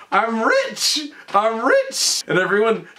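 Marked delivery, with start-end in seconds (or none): 0.1-1.1 high, gleeful "I'm rich!"; excited shout. Retold or imitated. Herman looks at the check, gleeful cry. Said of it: "he goes". 1.3-2.2 high, gleeful "I'm rich!" rising; excited repeat. Retold or imitated. Herman repeats his win, gleeful cry. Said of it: "he goes".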